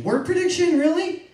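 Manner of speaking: scoffing outburst of "really?"; incredulous. Acted. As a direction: repeat it as if it's absurd, pitch jumping up. Repeats the term in disbelief.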